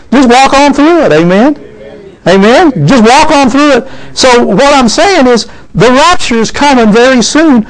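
high, rising preacher's exhortation; fervent preaching. Real, not acted. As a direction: build to a rousing call for praise.

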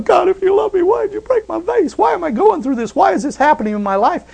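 high, whining mock lament to God; hammed-up high panicky prayer; panicked complaint. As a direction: imitate a believer freaking out, voice up high. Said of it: "We start going".

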